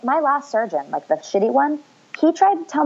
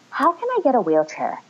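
higher-pitched mimicked asker; mimicked question; 6 semitones higher than usual. Mimicking strangers asking about her wheelchair, higher questioning voice. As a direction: ask it higher, imitating people who message you.

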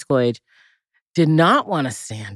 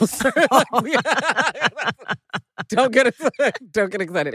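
laughing; 10 semitones higher than usual. Real, not acted. Laughing while telling soup story.

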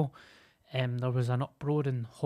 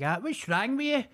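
put-on much higher, fretting old woman voice; mock fussing neighbour; 9 semitones higher than usual. Imitating the old neighbour fussing over his weight, high and fretful. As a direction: squeak it out like a nosy neighbour. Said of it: "she's like".

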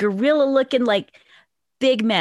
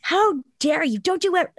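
higher-pitched indignant scolding, retold; mock outraged telling-off; cussing him out; 5 semitones higher than usual. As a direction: act out telling him off, without shouting. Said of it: "I just start cussing him out".

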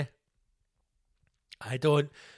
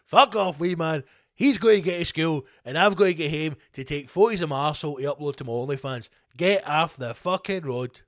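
loud, raised mock road rage; angry driver rant; 2 semitones higher, 7 dB louder than usual. Driver yells at protesters blocking the road, angry rant. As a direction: bellow it at a protester blocking you.

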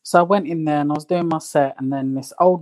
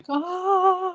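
blissful sigh; 13 semitones higher, 3 dB quieter than usual. Pretend. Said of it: "Yeah, I'm like".

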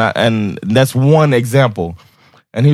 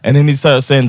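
ordinary delivery

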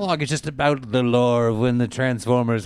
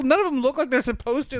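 normal talking voice

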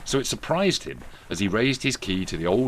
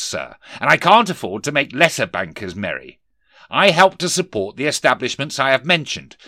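higher, louder boss voice of a sneering Scrooge; stern miser boss; 5 semitones higher, 7 dB louder than usual. Acted. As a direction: bark it down at the clerk. Scrooge refuses.

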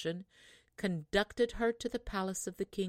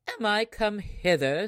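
louder, haughty scoffing question; mock the lady who asks for water; proud, saucy; 3 semitones higher, 7 dB louder than usual. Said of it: "said the proud, saucy one".

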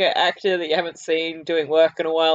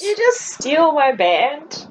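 mock outrage; 6 semitones higher, 3 dB louder than usual. Mock outrage over stolen band.

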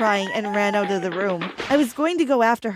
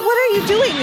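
high, loud startled cry; surprised by unwanted kisses, loud cry; 12 semitones higher, 6 dB louder than usual. A narrator's reading. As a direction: shove him off with a shocked yell. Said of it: "I cried, pushing him away".

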